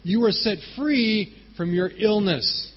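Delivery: high, loud, slowing proclamation; ringing, drawn-out pronouncement; calling out. Retold or imitated. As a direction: declare it slowly to the whole room. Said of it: "He calls her out".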